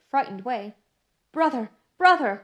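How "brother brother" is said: tearful cry. A narrator's reading. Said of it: "cried Miss Pross, bursting into tears".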